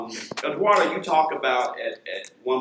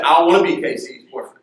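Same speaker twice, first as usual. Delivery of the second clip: put-on boy's quick protest; 4 semitones higher, 8 dB louder, faster than usual. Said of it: "he said".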